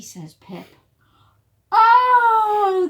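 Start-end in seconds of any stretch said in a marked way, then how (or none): 1.7-2.9 very high, loud gasp of surprise. Acted. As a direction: gasp it like the whole crowd is shocked. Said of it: "the crowd gasped".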